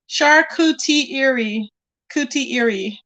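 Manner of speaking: very slow "charcuterie" drawn out, then "cootie eerie"; slow tongue-twister try. Shown as a demonstration. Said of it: "It's like a tongue twister".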